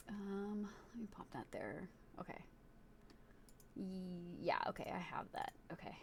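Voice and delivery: whispered aside to self. Real, not acted. Streamer doing setup, whispering to themselves. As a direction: mutter it under your breath.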